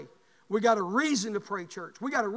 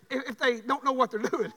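ordinary talk, nothing marked

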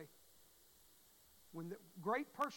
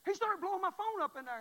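loud and high telling; excited; 11 semitones higher, 11 dB louder than usual. Real, not acted. Preacher telling church about phone call, loud and high. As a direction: build the story's peak.

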